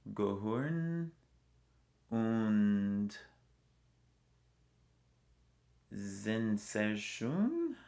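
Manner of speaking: slow, deliberate German words; careful German. Shown as a demonstration. Traveler put on the spot speaks German slowly. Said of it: "Can you say anything?".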